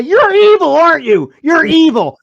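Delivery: loud, high-pitched mock accusation; calling someone out. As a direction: accuse them outright, voice jumping up loud. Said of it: "you should call him right out".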